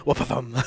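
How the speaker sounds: "Wapathum" with a lisped th; native pronunciation. Shown as a demonstration. Vlogger demonstrating the original word for possum. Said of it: "we would be saying".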